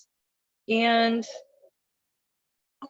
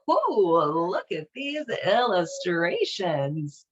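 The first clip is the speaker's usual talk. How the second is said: usual speaking voice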